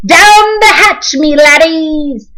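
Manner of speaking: high, loud, slow pirate yell; a captain roaring a toast. A narrator's reading. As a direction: bang the table and bellow it. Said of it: "pounded his fist on the table and yelled".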